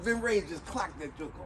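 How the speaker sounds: usual speaking voice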